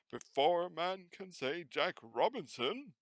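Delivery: put-on old Fezziwig calling his apprentices; rich fat jovial voice. A narrator's reading. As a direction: call out like a jolly old master.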